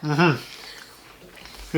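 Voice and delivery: chewing hum; mouth full. Real, not acted. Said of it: "I'm talking with my mouth full".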